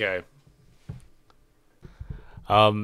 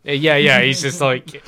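normal talking voice

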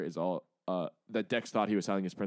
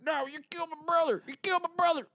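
very high, strained shouting; avenging brother, high-pitched and frantic; frantic grief; 4 dB louder than usual. Retold or imitated. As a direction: scream for revenge at his brother's killer. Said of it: "he's like".

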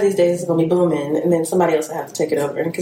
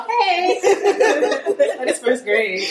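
laughing; 7 semitones higher, much slower than usual. Real, not acted. Teasing, laughing.

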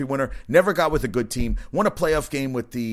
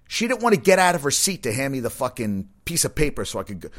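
usual speaking voice

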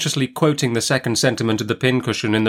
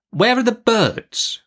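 high, slow, pointed question; demanding Miss Betsy; 13 semitones higher, slower than usual. A narrator's reading. Aunt quizzes mother about the missing rooks, demanding.

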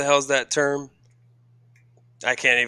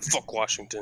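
lower, much softer grumble; bitter mutter; 5 semitones lower, 11 dB quieter than usual. Retold or imitated. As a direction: curse under the breath as the announcer. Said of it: "Jake Delhomme's like".

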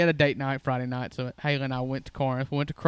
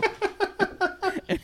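high-pitched burst of laughter. Real, not acted. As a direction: laugh breaking up high and squeaky. Cashier slaps frozen turkey.

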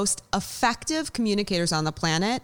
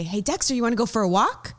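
high, quick, eager voice to the dog; excited pet talk; 3 semitones higher, faster than usual. Shown as a demonstration. Owner asks her dog about a walk, high and excited. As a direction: sell the walk with bouncy excitement. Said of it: "that's how I talk to him".

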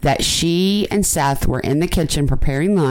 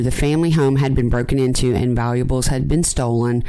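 ordinary talk

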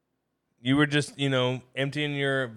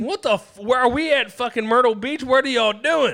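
much louder and higher, furious shouting at the kidnappers; angry hungover yelling; 6 semitones higher, 5 dB louder than usual. Pretend. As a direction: roar like you just woke up mad and thirsty. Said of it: "I'm waking up angry".

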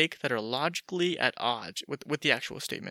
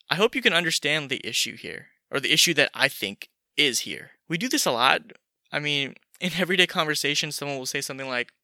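normal talking voice

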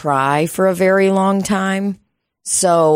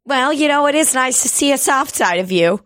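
higher, prissy mimicry of the woman at the bar; mocking coworker voice; 8 semitones higher than usual. Retelling the bar coworker's line, mock sweet. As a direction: parrot her sugary remark back, mocking. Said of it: "my relationship with those cats".

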